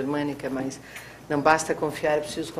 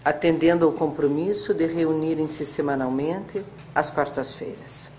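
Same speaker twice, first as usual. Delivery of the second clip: dictating; 5 dB quieter than usual. Real, not acted. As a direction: dictate it to the clerk, punctuation aloud. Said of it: "ponto e parágrafo".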